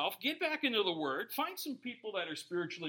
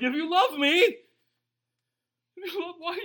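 high, loud complaint breaking into a laugh; half-laughing yell at heaven; mock outburst at God; 11 semitones higher, 13 dB louder than usual. Believer on a bad day complaining to God, loud and laughing. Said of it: "You're like".

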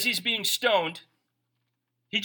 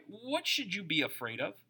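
ordinary talk, nothing marked

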